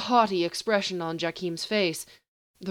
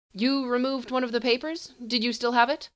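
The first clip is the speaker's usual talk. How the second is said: higher, probing sorcerer; haughty sorcerer; 7 semitones higher than usual. Acted. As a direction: question him coolly from above. Sorcerer questions, much higher.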